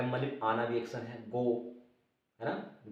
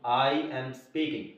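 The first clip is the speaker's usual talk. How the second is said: correct form slowly; much slower than usual. Shown as a demonstration. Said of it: "इसके अंदर ING जोड़ दिया".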